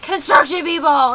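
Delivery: loud, accusing call-out; angry shout. Retold or imitated. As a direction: yell at the workers. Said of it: "you're just like".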